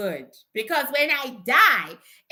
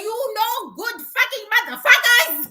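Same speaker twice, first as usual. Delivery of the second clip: raised, higher-pitched cursing rant; mock screaming; 6 semitones higher, 4 dB louder than usual. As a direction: re-enact yelling at useless relatives. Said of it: "screaming at them at three A M".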